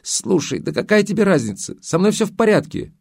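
sudden high indignant outburst; flaring up. A narrator's reading. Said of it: "но тут же взвилась".

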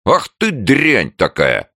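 high, loud, slow indignation; flustered outrage. A narrator's reading. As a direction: burst out scandalised. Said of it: "переполошились за дверью". (возмущённый переполох)

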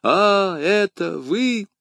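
high, drawn-out cry of a dying man; high-pitched acted outcry; anguished cry. A narrator's reading. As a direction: cry out in agonised recognition. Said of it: "вскричал Бризмон".

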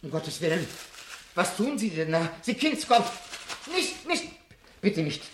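high, frantic cries of protest; horrified pleading. Acted. Old professor begs the fireman to spare the Bible, panicked high cries. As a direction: beg him to stop in panic.

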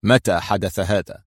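slow, coolly detached question; understated chill, slowly delivered; cold. A narrator's reading. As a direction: ask coldly and take your time. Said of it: "ثم قال في برود".

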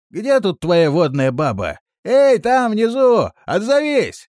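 loud, high call with drawn-out words. A narrator's reading. Fellow calling down the well for an answer, loud shout. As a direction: holler down to someone far below. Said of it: "крикнул он".

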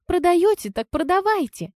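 high-pitched, impatient character retort; snippy woman's voice, pitched high; curt challenge. A narrator's reading. Лизка keeps up her curt rebuff.

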